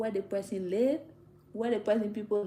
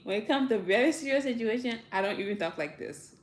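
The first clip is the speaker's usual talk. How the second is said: ordinary talk, nothing marked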